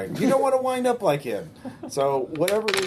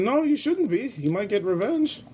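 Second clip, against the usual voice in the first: put-on old grandma voice; 9 semitones higher than usual.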